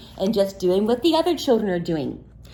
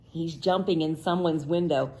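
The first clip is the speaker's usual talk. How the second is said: ordinary delivery, nothing marked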